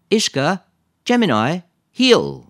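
loud, high-pitched dog commands; calling dogs. A narrator's reading. Lisa orders her dogs to heel, calling out. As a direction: call each word out firmly and separately.

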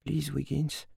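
hushed little appeal for help; whispering. A narrator's reading. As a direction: whisper a small, pleading wish to yourself. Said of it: "en chuchotant".

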